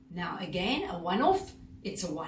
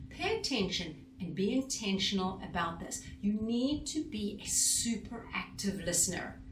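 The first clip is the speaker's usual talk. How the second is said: ordinary talk, nothing marked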